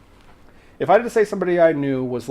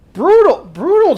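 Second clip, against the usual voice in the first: loud exclamation; 11 semitones higher, 8 dB louder than usual. Real, not acted. Reacting to chat teasing.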